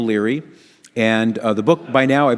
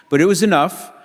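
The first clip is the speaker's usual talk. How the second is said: ordinary delivery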